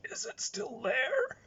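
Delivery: high, slow, strained whine; high, drawn-out worried plea; sweaty cartoon guy. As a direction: whimper it like a scared bald man. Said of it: "the guy's just saying".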